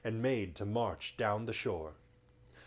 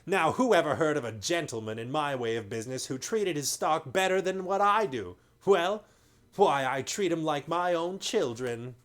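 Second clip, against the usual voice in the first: higher, louder mock-hurt trader; indignant slaver; 5 semitones higher, 5 dB louder than usual. A narrator's reading. Slaver defending how he treats his stock, mock indignant. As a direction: protest too much.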